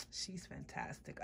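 whispered aside; soft whispered praise of the doll. Real, not acted. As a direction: breathe it, no voice.

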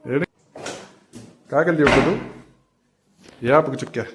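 put-on high, coaxing baby talk to a cat; pet talk. Owner coaxing the cat Mau, high baby talk. Asking the cat why it cries.

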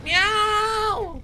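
high, drawn-out yowl; loud cat meow. Pretend. Player starting speedrun strats, high loud cat yowl. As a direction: belt out one long high meow. Playful battle meow in game.

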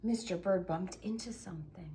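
hushed storyteller whisper; suspenseful whisper in the dark. A narrator's reading. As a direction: breathe it like a secret.

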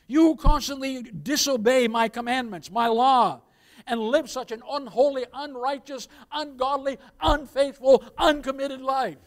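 loud, building denunciation; preacher thundering Christ's condemnation; fiery rebuke. Acted. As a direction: lash out at the disobedient.

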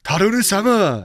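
put-on slow, falling Japanese-accented greeting; mock Japanese accent. Friend imitates Shogun as the chef, mock Japanese accent. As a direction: call it like a samurai-drama Japanese accent. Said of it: "Can you do the accent for me".

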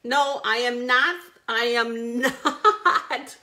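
slow, laughing through the words; laughing denial. Real, not acted. Host laughing off a viewer's teasing suggestion. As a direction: laugh as you refuse. Answering Paula's teasing comment.

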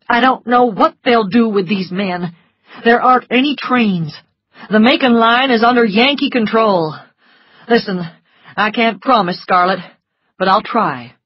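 tired, honest refusal softening; grim doctor. A narrator's reading.

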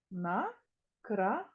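Накрапає stretched as На-кра-па-є; slow syllable split. Shown as a demonstration. Teacher slowly syllabifying for children. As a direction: drag it out, one syllable at a time. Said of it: "Скільки складів?".

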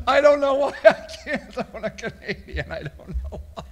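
high at first, sinking into laughter; laughing helplessly. Real, not acted. Host answering the Canadian question, laughing helplessly. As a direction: wheeze the words out through laughter.

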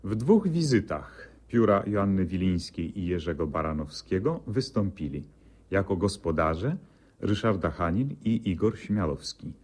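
usual speaking voice

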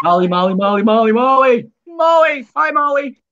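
calling the dog. Acted. Host calling Molly the dog, squeaky and excited.

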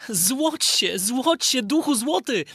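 put-on high boyish voice calling the spirit; a boy pleading with a magic spirit; Aladyn summoning. Aladyn calls the golden spirit to shine, high boyish voice. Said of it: "zawołał Aladyn".

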